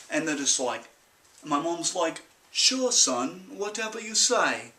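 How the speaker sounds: put-on farm boy's accent with Mom's offhand reply; country-accented telling, easing off for Mom; put-on rural accent. Mom humours her son, in a put-on rural accent.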